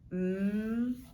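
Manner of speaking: M as a long held hum "mmm"; isolated letter sound. Shown as a demonstration. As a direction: sustain the sound of M, not its name. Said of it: "I hear a M sound".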